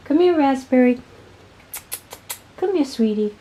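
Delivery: high, sweet coaxing call to a pet; coaxing pet talk. Real, not acted. Vlogger calling her pet Raspberry to come over, high coaxing.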